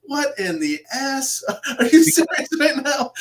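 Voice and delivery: loud, high mock astonishment; mock disbelief. Investigator reacting to a spirit's reply, loud mock disbelief. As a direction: blurt it out loud and high, laughing at the absurdity.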